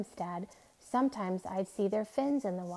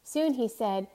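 ordinary delivery, nothing marked

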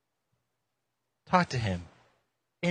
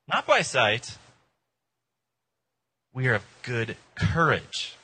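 louder, measured Bible reading voice; scripture reading; 2 semitones higher, 4 dB louder than usual. Acted. Pastor reading Second Corinthians to his church, declaiming. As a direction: proclaim the verses to the room. Reads scripture aloud to congregation.

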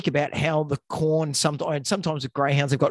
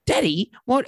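put-on child voice; 7 semitones higher than usual. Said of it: "the kids said".

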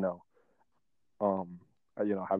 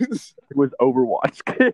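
laughing through it; 3 semitones higher, 8 dB louder, slower than usual. Real, not acted. Confessing game skins, laughing.

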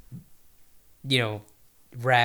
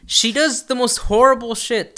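laughing; 9 semitones higher, 7 dB louder, slower than usual. Real, not acted. Laughing emphasis.

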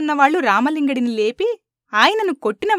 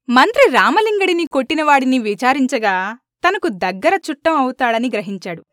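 plain reading voice

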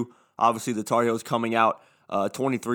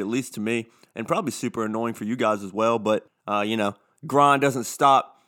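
usual speaking voice

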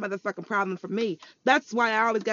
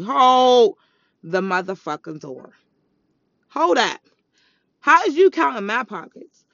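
outraged hold up; 8 dB louder than usual. Real, not acted. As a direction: cut them off, offended. Outraged at people judging money.

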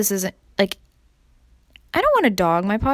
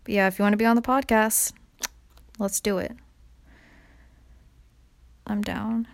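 usual speaking voice

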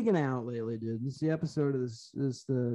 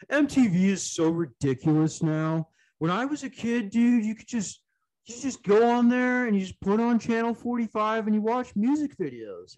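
higher-pitched mock complainer, emphatic and nostalgic; griping forty-year-old; 7 semitones higher than usual. Podcaster mimics forty-year-olds moaning about MTV, mock griping. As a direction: imitate an indignant old-timer grumbling about today. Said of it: "everybody that was forty".

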